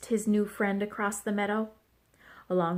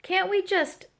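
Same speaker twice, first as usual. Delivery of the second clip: high, whiny plea; moaning; 9 semitones higher than usual. A narrator's reading. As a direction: moan it, begging for a break. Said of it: "Goose moaned".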